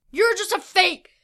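loud, high-pitched shout; yelled accusation. A narrator's reading. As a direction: hurl the accusation out at a yell. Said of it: "Noah yelled".

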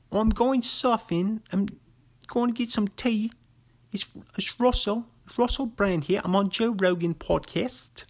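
a terrible British impression; bad British accent. Playing Russell Brand on the podcast in a bad British accent. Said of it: "It's terrible".

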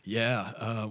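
ordinary talk, nothing marked

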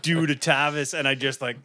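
normal talking voice

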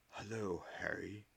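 put-on soft, genial old headmaster; a hint of an amused old wizard; Dumbledore's voice. A narrator's reading. Dumbledore greets Harry softly.

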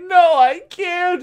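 high, slow, crying; crying refusal. Retold or imitated. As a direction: whimper a refusal. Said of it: "she's like".

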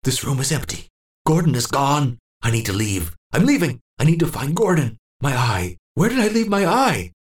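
high, agitated muttering; confused old man looking for his false eye; frantic searching. Acted. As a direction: hunt around in rising panic.

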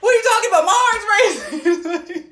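very high, loud frantic protest; mock frantic. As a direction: insist in a stoned panic. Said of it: "I'd be like".

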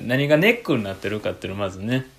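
usual speaking voice

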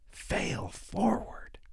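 slow, high, whispered slogan; mocking whisper. Comic mocking the paper's slogan, slow whisper. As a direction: breathe the phrase like a mystic mantra.

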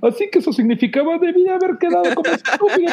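high mock realization. Imitating viewer realizing late.

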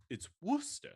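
high-pitched mock British correction; attempted British accent. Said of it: "how quickly you just abandoned ship".

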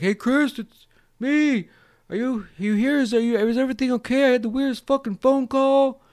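high, loud, put-on worried voice; mock calling out. Imitated Cotton calling to girlfriend, concerned. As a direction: call down the hall, high and loud.

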